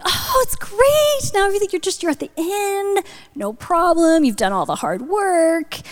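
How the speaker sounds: gushing encouragement. Retold or imitated. Friend to woman in labor after epidural, gushing encouragement. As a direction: cheer her on in a high, singsong voice.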